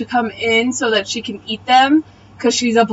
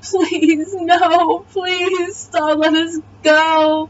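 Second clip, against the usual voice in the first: high, whimpering fake plea; hammed-up weepy begging, pitched up; mock crying; 6 semitones higher, much slower than usual. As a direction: imitate a tearful captive begging. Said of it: "the Swedish bitches are crying".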